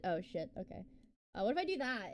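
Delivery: ordinary talk, nothing marked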